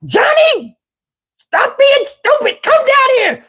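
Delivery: very high, drawn-out grandma holler; high-pitched scolding call; yelling grandma. Retold or imitated. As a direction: imitate a grandma scolding from downstairs.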